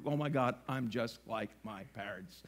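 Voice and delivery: low, soft mock horror; weird dismayed realization. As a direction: drop low and quiet, dawning dismay. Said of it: "I said it in a weird way".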